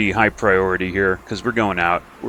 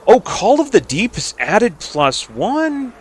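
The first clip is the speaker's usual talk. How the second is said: ordinary talk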